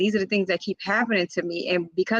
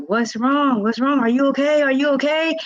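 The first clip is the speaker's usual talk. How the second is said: higher, louder, worried repeated questions; worried husband; 7 semitones higher, 4 dB louder than usual. Retold or imitated. Husband woken at night asks his choking wife, worried. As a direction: voice the husband checking on her in alarm. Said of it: "and he's like".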